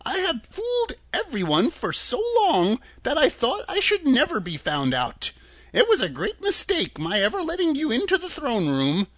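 put-on high-pitched old-man confession; timid, regretful little fellow; rueful little man. A narrator's reading. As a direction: lament the mistake in a high, fussy voice. Little man voiced in story.

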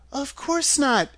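put-on high-pitched girlish voice; little girl answering. A narrator's reading. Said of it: "answered Dorothy".

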